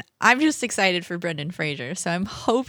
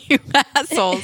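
laughing outrage; 5 semitones higher, 4 dB louder, faster than usual. Real, not acted. Mock outrage at friends, laughing.

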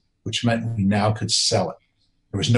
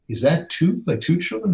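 surprised; 7 semitones higher than usual. Retold or imitated. Seeing twins on the monitor.

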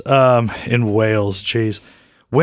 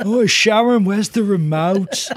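[name] calling Sharon; 6 semitones higher than usual. Retold or imitated. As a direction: drag out the question like a muddled old rocker. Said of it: "Sharon Osbourne".

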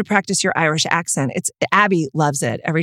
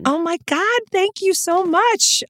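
much higher, slow, overwhelmed thank-you; gushing thanks; 13 semitones higher, slower than usual. Real, not acted. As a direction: stretch the thanks out, genuinely touched. Friend praises her film.

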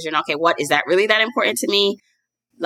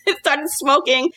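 laughing; 9 semitones higher, slower than usual. Real, not acted. Laughing retelling car breakdown.

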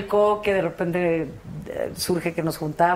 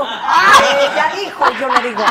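loud laughing, much higher; 6 semitones higher, 8 dB louder than usual. Real, not acted. Guest cracking up over her own joke, loud laughter. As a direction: laugh hard while saying it.

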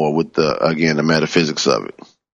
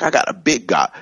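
higher-pitched imitation of a mentor; Reverend Coleman; 8 semitones higher than usual. Preacher fondly quoting a beloved teacher, imitated higher. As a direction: quote her proudly, pitched up. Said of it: "She's like".

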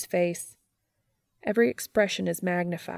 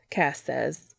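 plain reading voice

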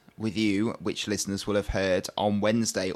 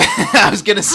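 laughing mock whine of the excuse; 10 semitones higher, 11 dB louder than usual. Host teasing a contestant, laughing mock imitation. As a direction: tease her by parroting. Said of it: "that's what people always say".

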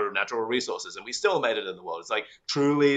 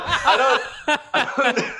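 high, laughing I don't; laughing fluster; 8 dB louder, slower than usual. Retold or imitated. Guest mocking her own panic over the question. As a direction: giggle helplessly at a blank mind. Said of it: "Honestly, just stressed".

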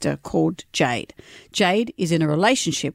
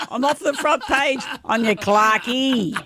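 higher, drawn-out mock delight; Molly Meldrum; 5 semitones higher, slower than usual. Host imagining who is thrilled by the scandal, gleeful impression. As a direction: imitate a thrilled celebrity, slowly. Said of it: "Only person who's thrilled with that is Molly Meldrum".

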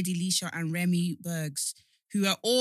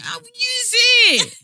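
high, loud and stretched out; indignant high-pitched yell at a friend; exasperated shout; 13 dB louder, much slower than usual. Real, not acted. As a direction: protest at full volume, slowly. Bickering over a shared account.

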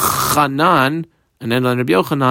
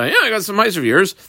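field owner; 6 semitones higher, faster than usual. Retold or imitated. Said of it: "informed by this, uh, field owner".

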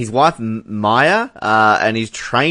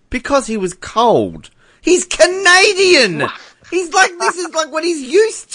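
exasperated disbelief. Real, not acted. Mocking the excuse of cold.

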